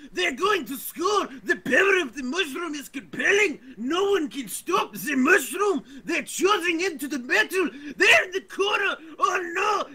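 high, shouted, frantic match commentary; football commentator voice. Acted. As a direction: scream it like a goal call. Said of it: "commentate in a certain voice".

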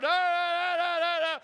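loud, high repeated shouting; arguing crowd. Shown as a demonstration. Showing the congregation a crowd going nuts, noisy arguing. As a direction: argue noisily over everyone. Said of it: "like that, like you're arguing".

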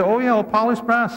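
mock know-it-all tone; mock breezy knowingness. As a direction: pretend it's all obvious. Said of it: "you can't read that and say".

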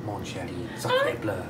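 mock French. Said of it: "act like I'm at a bistro and go".

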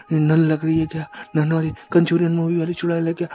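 alarmed. Real, not acted. Recognises a nun witch.

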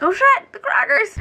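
high, loud startled realization; sudden panic. Retold or imitated. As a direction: blurt it out as the mistake hits. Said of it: "and I was like".

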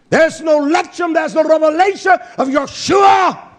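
high, loud pulpit shout; impassioned preacher in full flow; full-force preaching. Real, not acted. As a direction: build the sermon to a ringing cry.

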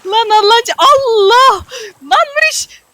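high desperate yelling at a friend; panicked shout. Real, not acted. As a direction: cry out as the boat slips away. Boat drifting away, panicking.

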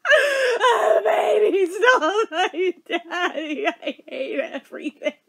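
hammed-up wail, starting high and loud, sinking; mock sobbing. Sobbing child in mom's hospital room, hammed-up wail. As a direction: imitate a kid's sobbing meltdown, half laughing. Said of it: "I am just a sobbing fucking mess".

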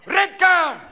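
high, loud stern call; referee's shout. Acted. Referee sending a player off, shouted call. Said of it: "the referee comes over".

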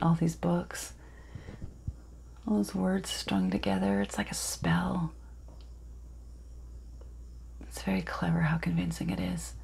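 very soft, half-whispered musing; hushed dramatic monologue. Acted. Miranda muses on books like spells, hushed. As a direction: let it sink almost to a whisper.

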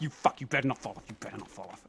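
ordinary talk, nothing marked

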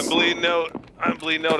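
loud urgent call for help. Real, not acted. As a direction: shout that you're bleeding out. Wounded teammate calling out.

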